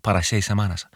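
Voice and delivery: normal talking voice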